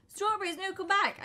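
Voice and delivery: high desperate call; shouting after someone. Real, not acted. Calling a fleeing teammate back, loud and high. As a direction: yell for them to come back.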